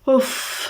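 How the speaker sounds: long, breathy puff of exhaustion; weary huff. Acted. Sitting on the bed after a long day, weary huff. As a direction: blow out a tired sigh.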